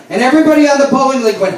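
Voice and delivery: ordinary talk, nothing marked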